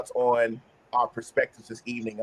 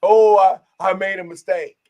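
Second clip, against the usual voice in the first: vague excuse; 7 semitones higher, 9 dB louder than usual. Shown as a demonstration. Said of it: "I can't just say".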